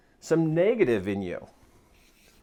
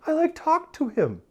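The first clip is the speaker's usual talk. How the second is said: put-on high, loud, eager kid recounting; excited son; 9 semitones higher, 6 dB louder than usual. Son telling dad he refused the voice, excited. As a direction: imitate a boy proudly reporting to Dad. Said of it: "And he says".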